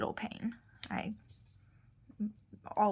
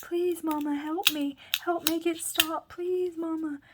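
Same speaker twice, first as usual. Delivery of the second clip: imitating a child's pleading; 8 semitones higher than usual. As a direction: echo a scared boy pleading with his mom. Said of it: "he was screaming".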